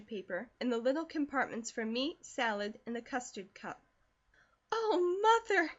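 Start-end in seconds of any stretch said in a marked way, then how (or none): 4.7-5.7 much higher, louder joyful cry; thrilled girl cries out to her mother, louder; excited cry. A narrator's reading. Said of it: "cried Elnora".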